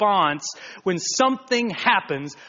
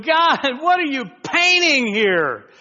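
loud, much higher plea to God; exasperated cry; 10 semitones higher, 6 dB louder than usual. Retold or imitated. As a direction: call up in bewilderment. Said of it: "you go".

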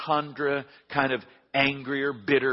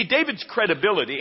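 ordinary talk, nothing marked

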